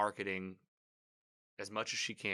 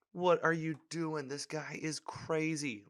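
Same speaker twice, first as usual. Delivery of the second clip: high, loud and slow, stunned; real shock at what he just saw; drawn-out incredulous outburst; outraged disbelief; 8 semitones higher, 4 dB louder, slower than usual. Real, not acted. Reacting to Homelander outing Maeve.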